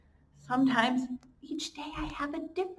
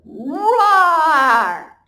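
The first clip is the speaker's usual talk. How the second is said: loud playful lion roar; 5 semitones higher, 16 dB louder than usual. Shown as a demonstration. Teacher roars like a lion to show her class. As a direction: roar big for the kids. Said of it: "Can you roar like a lion? Go".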